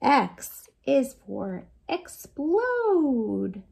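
ordinary delivery, nothing marked

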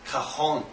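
cajon said ka-HON, breathy H for J; Spanish pronunciation. Shown as a demonstration. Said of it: "Spanish pronunciation is".

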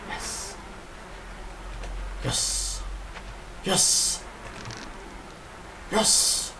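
hushed, hissy whisper of triumph; whispered cheer. Real, not acted. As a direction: whisper each little victory to yourself. Quietly cheering own progress in game.

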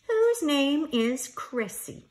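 ordinary delivery

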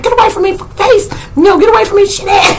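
high-pitched squawk of alarm; imitating someone frantically warding off an animal; frightened mock yell. Retelling a scared man driving off a mountain lion, high mock yell.

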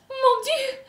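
very high, theatrical French cry; horrified exclamation. A narrator's reading. Antoinette horrified for her son, crying out. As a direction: squeal it high in shock.

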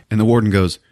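normal talking voice

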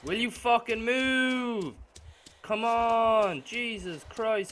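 slow, loud, exasperated yelling; furious shout. Real, not acted. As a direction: scream at her to move. Screaming at slow character.

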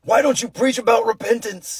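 high, strained outburst as if a vein is popping; hammed-up indignant scolding; mock angry zealot. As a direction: caricature an irate believer demanding repentance. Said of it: "got a vein, and they're just like".

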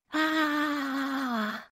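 long high muted "aaah"; high, soft, stretched-out cry; embarrassed wail. Acted. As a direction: let out a small drawn-out embarrassed squeal. Embarrassed scream on realizing.

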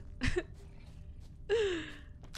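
a real burst of high laughter; short high giggle, real; high breathy laugh. Real, not acted. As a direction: laugh high at your own joke. Laughing at her troll impression.

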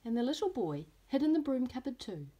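lively storybook reading with voiced characters; reading to children. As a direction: read it like story time for little ones. Teacher reads picture book aloud.

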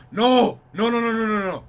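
loud, high panicked cries; alarmed. Real, not acted. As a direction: blurt it out in sudden alarm. Spotted while sneaking in game.

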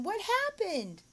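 put-on high, slow question to a child; astonished question. Shown as a demonstration. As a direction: model a shocked question for a pupil. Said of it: "You're asking them".

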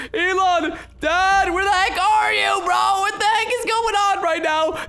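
searching for dad, shouting; panicked calling. Acted. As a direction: call out for dad.

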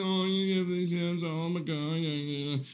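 soft mock cooing; hushed, gushy imitation; imitating cat cooing. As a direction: coo quietly over a pet, mocking her. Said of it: "She's like".

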